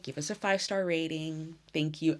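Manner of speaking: ordinary talk, nothing marked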